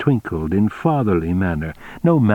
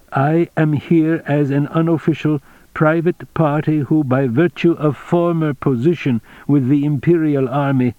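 higher-pitched, even diplomatic officer; the general, officially formal; 6 semitones higher than usual. Acted. General replies formally to Captain Wegener, higher measured character voice. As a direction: recite a careful official disclaimer.

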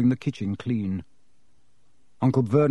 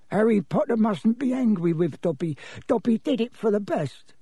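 high, small elf voice; high-pitched, apologetic elf; pleading house-elf; 8 semitones higher than usual. Acted. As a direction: plead nervously as a little creature. Plays Dobby the house-elf.

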